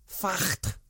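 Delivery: a quick, badly faked Scouse accent on one word; mock Scouse, clipping the vowel of Facht. Ranting pundit tosses in a poor Scouse "Facht". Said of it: "That's a terrible effort at a Scouse accent".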